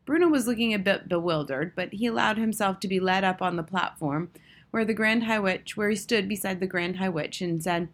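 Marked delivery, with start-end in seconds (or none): none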